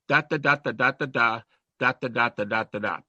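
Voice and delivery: tapping out a steady beat on da and dot; rhythmic cadence. Shown as a demonstration. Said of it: "it's gonna have a cadence to it".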